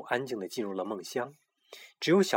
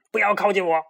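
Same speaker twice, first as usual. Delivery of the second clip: loud, higher harsh warning; sharp warning; 7 semitones higher, 6 dB louder than usual. A narrator's reading. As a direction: warn them off fiercely. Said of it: "小胖墩儿厉声警告大家". (厉声警告)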